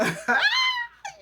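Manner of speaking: a loud, high laugh that cracks into a sob; laugh breaking into a cry. Real, not acted. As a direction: laugh through real tears, loud and high. Reactor overcome watching [name] interview.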